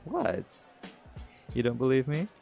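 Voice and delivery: ordinary talk, nothing marked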